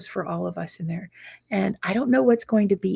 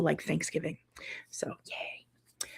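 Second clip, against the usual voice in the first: whisper; 2 semitones lower, 13 dB quieter, slower than usual. Real, not acted. Tired aside about no treatment.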